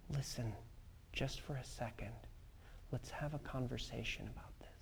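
very soft, low, soothing reassurance; calm a raging child with hushed, spaced-out phrases; gentle whisper. Acted. Parent soothing a screaming six-year-old daughter, gentle whisper. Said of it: "I responded with a gentle whisper".

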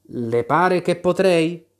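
slow, mournful little question; a sorry paper knave admitting it; sadly. Acted. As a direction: sad knave of cards.

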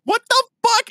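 very high, loud startled shriek; shocked shriek. Retold or imitated. Woken at three a.m., shrieking in shock. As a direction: scream it, jolted awake. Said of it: "And I'm like".

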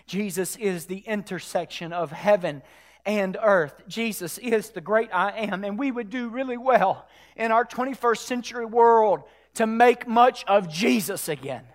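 ordinary delivery

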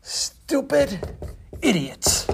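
slow, spaced-out insult, word by word; written in big words. Acted. Angry visitor writing on the man's door, slow and emphatic. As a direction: spell out the insult slowly, pausing between words. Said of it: "he wrote in big words".

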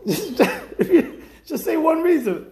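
high laughing plea to the absent guide; laughing protest. Real, not acted. Teacher mocking the tour guide to the class, laughing high. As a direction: laugh it out, half-mock exasperated.

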